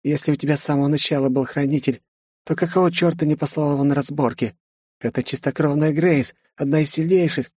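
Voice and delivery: mocking villainess. A narrator's reading. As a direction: play the beaten yet taunting enemy.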